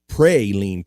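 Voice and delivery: praline said as PRAY-leen, drawn out; long PRAY pronunciation. Acted. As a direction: stretch the first syllable of praline like pray. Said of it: "P R A Y".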